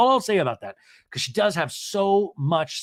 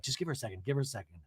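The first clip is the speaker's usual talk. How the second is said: soft, lower mock soothing; hushed urging; 5 semitones lower, 11 dB quieter, much faster than usual. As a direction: murmur it gently to calm the room. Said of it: "Heather says".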